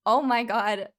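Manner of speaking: loud, stunned praise; amazed oh my god. Real, not acted. Streamer praising a producer's live guitar, loud awe. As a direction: let the awe burst out.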